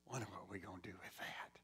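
hushed whisper, low and breathy-free of voice. Real, not acted. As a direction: drop to a whisper after the shout. Sudden drop after the shout.

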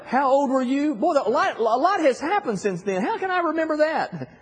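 high, teasing exaggerated surprise; playful mock disbelief. Father joking with his grown son, hammed-up high surprise. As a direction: pitch way up, hamming up forgetfulness. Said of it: "And I said".

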